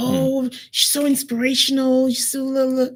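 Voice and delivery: high, gushing parody of an admirer; mock a starry-eyed follower; mocking fan. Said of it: "Are you being led to the slaughterhouse?".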